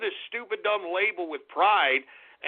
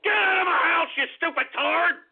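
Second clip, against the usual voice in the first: angry shout; 9 semitones higher than usual. Retold or imitated. Imagined parents screaming at their grown son, furious shout. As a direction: throw him out at the top of your voice. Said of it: "what his ... parents should be doing is".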